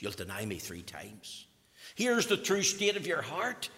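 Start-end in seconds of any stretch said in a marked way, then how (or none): 0.0-1.5 hushed whisper. Acted. First telling, hushed.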